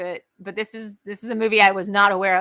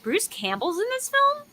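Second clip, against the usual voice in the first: much higher, startled recognition; surprised; 12 semitones higher than usual. Retold or imitated. Recalling the movie's opening with surprised, high-pitched delight. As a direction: recall the shock of hearing a familiar voice. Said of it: "I was like".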